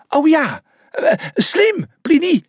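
high, loud, hesitant reply; high-pitched exclaimed realisation; eager agreement. A narrator's reading. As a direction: agree eagerly, stumbling a little. Zebra answers the shouting mouse.

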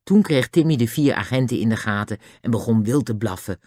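ordinary delivery, nothing marked

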